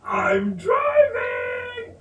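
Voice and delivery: put-on very high, slow, loud little-boy yell; toddler shout. Toddler shouting at the family from the driver's seat, very high. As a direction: stretch it out like a proud toddler. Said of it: "shouted out at us".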